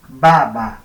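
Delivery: "ba, ba" loud with a buzzing voiced b; loud demo "ba, ba" for the class. Shown as a demonstration. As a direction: voice the b strongly in "ba, ba". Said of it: "Cuando yo cambio una por otra".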